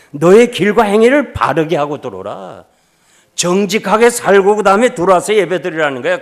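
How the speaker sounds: 높고 크게 외치는 설교 톤; 성도들에게 말씀을 힘껏 선포하듯. Acted. 하나님 말씀을 크게 선포. (힘 있게 외치는 설교)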